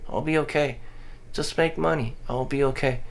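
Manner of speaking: usual speaking voice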